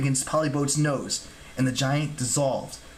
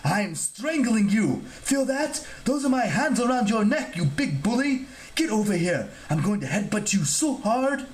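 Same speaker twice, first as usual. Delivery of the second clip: high-pitched, ranting statue; indignant squabbling, pitched up; furious fussy god; 9 semitones higher than usual. Acted. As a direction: rant threats at a bigger bully. Outraged god attacks the giant.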